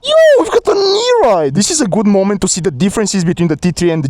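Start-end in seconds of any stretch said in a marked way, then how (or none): 0.0-1.5 excited shout. Real, not acted. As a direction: call it out thrilled. Friend doing a trick.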